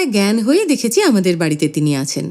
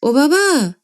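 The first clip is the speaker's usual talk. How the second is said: higher call across the house; mild call; 8 semitones higher, much slower than usual. Acted. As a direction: son calling his father. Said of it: "বাবা চেঁচিয়ে ডেকে বললেন".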